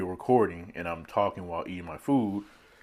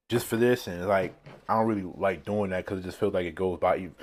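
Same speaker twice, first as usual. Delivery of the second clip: ordinary delivery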